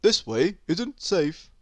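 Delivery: odd little creature voice, earnest; warning worm. A narrator's reading. A worm pops up to warn Miss Stubborn. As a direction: warn her gravely as a small worm. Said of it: "said a worm".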